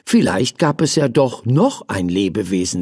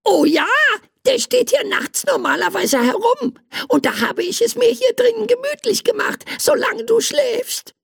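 tiny creature voice; faster than usual. Acted. Tiny creature explains itself.

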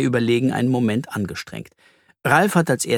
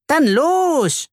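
very high, energetic call; rallying call; 4 dB louder than usual. Acted. Ralf rallies the detectives to go, calling out. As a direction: send the team off. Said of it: "ruft Ralf".

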